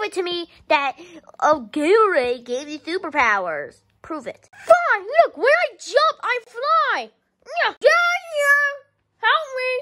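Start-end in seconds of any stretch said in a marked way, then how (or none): 4.5-9.7 squeaky high voice, shouting in panic; high-pitched yelling kid; high cartoon kid voice. Character voice jumping.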